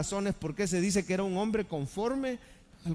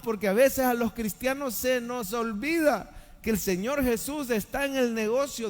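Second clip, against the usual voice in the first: usual speaking voice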